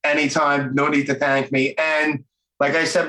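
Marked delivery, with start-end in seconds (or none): none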